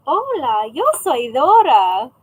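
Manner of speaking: slow, loud swooping cartoon greeting; creepy Dora. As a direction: do a creepy Dora hello, drawn out. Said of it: "I would say it in a really creepy voice".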